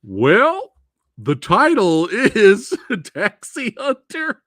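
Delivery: high, loud laughing reveal; laughing punchline. Retold or imitated. Answering Lady Fat Blood about the movie title, laughing. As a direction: crack up while delivering the title. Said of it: "And I was like".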